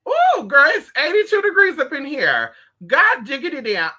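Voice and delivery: high, loud fanning-herself fuss; loud hot complaint. Real, not acted. Fussing to viewers about the hot room, loud and high. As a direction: squawk about the heat in the room.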